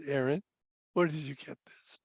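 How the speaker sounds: hushed, near-whispered question, falling at the end; very soft, low, serious father. Retold or imitated. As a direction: drop to a low, quiet parental probe. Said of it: "my voice got a little lower".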